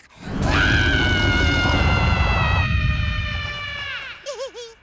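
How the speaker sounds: loud drawn-out roar. Acted. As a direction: let out a big animal roar. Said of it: "je vais juste rugir".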